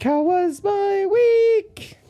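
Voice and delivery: high, loud, slow sung-out intro; mock announcer. Vlogger opening a segment, a high hammed-up intro call. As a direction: belt it like a show opener. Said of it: "let's do a little bit of".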